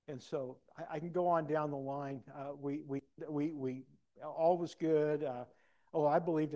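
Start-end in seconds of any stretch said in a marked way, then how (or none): none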